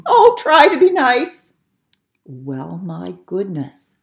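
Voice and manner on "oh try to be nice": high, loud scared begging; frightened plea. Acted. Lion pleading with mouse, frightened and high. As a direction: plead nervously. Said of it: "he whimpered".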